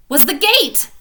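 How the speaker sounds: loud, bright reveal of the gate; excited. A narrator's reading. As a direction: burst out with the discovery.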